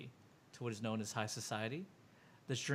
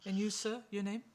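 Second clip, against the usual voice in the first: higher-pitched, formal dealer voice; polite dealer; 7 semitones higher than usual. Acted. Dealer asking a gambler's name, politely high-pitched. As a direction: ask courteously, pitched up. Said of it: "And he goes".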